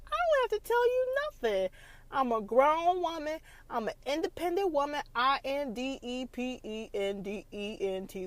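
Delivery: high, sassy grown-woman attitude; a high, mock-defiant younger self; sassy retort. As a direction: toss it off with high sass. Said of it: "That was me".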